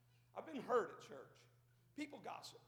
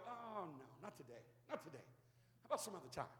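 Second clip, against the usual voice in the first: much softer, lower putting off; mock brush-off; 4 semitones lower, 9 dB quieter than usual. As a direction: dismiss it lazily. Mocking someone putting God off.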